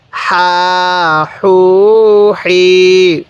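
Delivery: very slow, each vowel stretched long; drawn-out syllables. Shown as a demonstration. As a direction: stretch সা সু সি for a learner. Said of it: "এরকমভাবে শিখব।". (টেনে টেনে ধীরে উচ্চারণ)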